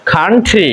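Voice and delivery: "খান্ট্রি" modelled alone, k and t aspirated; British pronunciation. Shown as a demonstration. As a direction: puff k and t in "খান্ট্রি", falling tune. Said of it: "তাহলে উচ্চারণ হবে". ("খান্ট্রি" মহাপ্রাণ খ ও ঠ)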